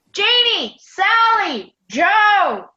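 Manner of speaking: urgent high call, one name at a time. Acted. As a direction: holler each name out urgently. Calls friends after hearing wolves.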